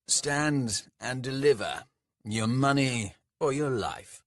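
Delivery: a lightly voiced bandit, slow and mocking; slow sardonic drawl. A narrator's reading. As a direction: draw it out with a cheeky sneer. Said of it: "in a slow, sardonic drawl".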